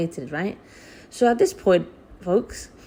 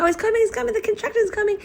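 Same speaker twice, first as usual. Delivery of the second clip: panicked cry; faster than usual. Retold or imitated. Labour pains, retold panic.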